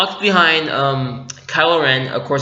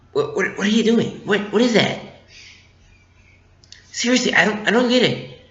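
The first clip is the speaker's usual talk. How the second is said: pitched-up, baffled protest; baffled Han Solo; 5 semitones higher, 3 dB quieter than usual. Retold or imitated. Fan voicing Han Solo in a retelling, baffled. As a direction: act out a confused, higher-voiced objection.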